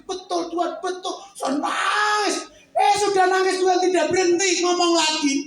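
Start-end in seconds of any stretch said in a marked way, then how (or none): 1.4-5.4 crying. Retold or imitated. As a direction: sob out the words.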